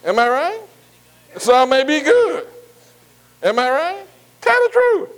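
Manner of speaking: preaching at full force to the congregation; fervent preaching. Acted.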